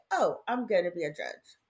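normal talking voice

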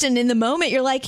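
usual speaking voice

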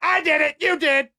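hammed-up fake argument to clear the room; mock marital squabble. Fake husband-wife fight at party.

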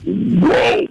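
watching his friend tremble after the jump, amazed; high amazed whoa. Retold or imitated. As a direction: react to a friend shaking like a leaf. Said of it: "And I'm like".

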